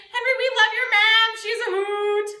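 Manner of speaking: loud, high, sing-song cheer; gushing friends. Acted. Friends praising Henry's mom at his bedside, loud gushing. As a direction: gush like a whole crowd of fans.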